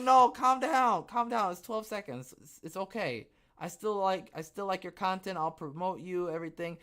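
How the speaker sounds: mock pleading. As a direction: soothe them in a hammed-up high voice. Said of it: "I'm like".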